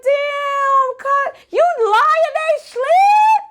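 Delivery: very high-pitched shouted astonishment; shocked disbelief. Retold or imitated. Retelling hearing the friends are asleep, shocked disbelief. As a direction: shriek it as if you can't believe it.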